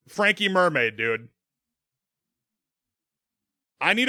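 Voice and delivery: fired-up pleading to a fellow filmmaker; excited demand. Real, not acted. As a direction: insist on it with total enthusiasm. Pleads with director for a prequel.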